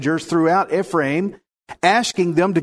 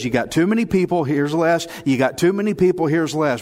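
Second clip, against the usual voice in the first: brisk God voice. Retold or imitated. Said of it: "God goes".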